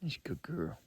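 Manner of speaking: soft, near-whispered comfort; soothing the dog. Real, not acted. Owner comforts the dog who protected her, soft and near whispered. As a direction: murmur to calm the dog.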